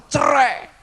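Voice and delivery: high, loud barked "Cerai."; a father's sharp, loud cry of "Cerai."; retold angry shout. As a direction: hurl the word "Cerai." like a furious father. Father demanding divorce, retold.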